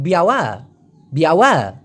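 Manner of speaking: বিয়াওয়া with a little push on the last আ; Malay pronunciation. Shown as a demonstration. Teacher demonstrates Malay বিয়াওয়া ending. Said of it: "আ দিয়ে আপনি ঢেউ খাইতে পারেন".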